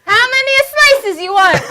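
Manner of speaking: very high mock Italian; Italian accent. Shown as a demonstration. Comedian repeats the pizza place line, Italian accent. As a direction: parrot it as an Italian pizza seller. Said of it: "I just did it in an Italian accent".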